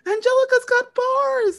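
high loud yelled song title; shrieked at full voice, no holding back; screaming. Retold or imitated. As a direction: belt it out like you're screaming in a supermarket. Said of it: "I started screaming in the supermarket".

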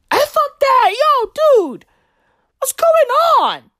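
falsetto, fast, playing a baffled official; high squeaky mock confusion. Mocking the Zambian minister in a high squeaky falsetto. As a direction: squeal it like a lost, betrayed crony. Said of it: "she's like".

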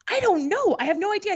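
high, loud, flustered denial; retold outburst of helpless confusion; bewildered protest.

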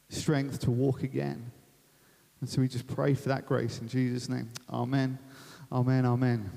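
low, unhurried prayer with long pauses; subdued, reflective praying voice; spoken prayer. Real, not acted. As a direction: lead the room gently in prayer. Leads a prayer.

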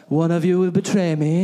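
dramatic movie Jesus. Retold or imitated. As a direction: play the grave movie Jesus announcing betrayal. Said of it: "Jesus is like".

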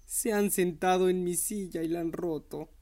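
lightly voiced small bear, high with a hint of tears; a small cub whimpering gently; little bear crying softly. A narrator's reading. Baby bear finds his broken chair, a mild tearful voice. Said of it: "dijo llorando con voz suave".

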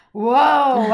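loud, stretched whoa of surprise; startled whoa. Retold or imitated. Mother feeling her milk come in, loud surprised whoa. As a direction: belt out a shocked whoa. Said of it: "And I was like".

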